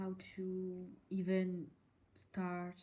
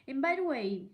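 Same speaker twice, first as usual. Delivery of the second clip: louder, higher burst; emphatic; 4 semitones higher, 8 dB louder, faster than usual. Real, not acted. Vlogger bursts in indignantly, louder and higher. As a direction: jump in with force. Outburst in rant.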